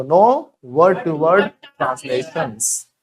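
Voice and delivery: slow chant; very slow repeat. Shown as a demonstration. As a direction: stretch every word for the class. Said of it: "बोलो".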